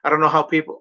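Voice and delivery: ordinary talk, nothing marked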